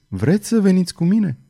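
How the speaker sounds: tender, lifted question; gentle request. A narrator's reading. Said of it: "i se adresă el cu blândețe".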